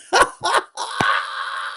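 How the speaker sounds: very high, long cackle; taunting cackle. Real, not acted. Host mocking fans who will bawl, taunting cackle. Cackles at rival fans' crying.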